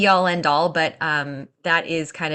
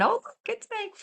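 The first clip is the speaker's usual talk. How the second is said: a high, soft mock surprise; 6 dB quieter than usual. Doula mimics the client realizing the date, with mock surprise. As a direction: feign a little jolt of discovery. Mock discovery of lost booking.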